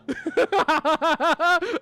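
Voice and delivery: loud burst of victory laughter; loud, giddy cackling; triumphant laugh. Real, not acted. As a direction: laugh hard with relief after the win. Laughs after winning.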